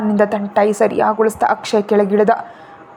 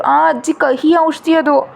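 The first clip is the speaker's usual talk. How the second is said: put-on higher, slow pouting child's complaint; sulky child; 5 semitones higher, slower than usual. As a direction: drag it out like a sulking little boy. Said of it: "ಮುಖ ಸಣ್ಣದ್ ಮಾಡ್ಕೊಂಡು ಹೇಳ್ದ".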